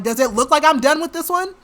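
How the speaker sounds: loud, high mock-indignant comeback; indignant retort. Man fending off an interrupting onlooker, indignant.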